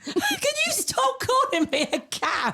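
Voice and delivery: high, drawn-out mock outrage; mock indignation. Real, not acted. Co-host objects to being called a cow, mock indignant. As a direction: squeal it in stretched-out protest.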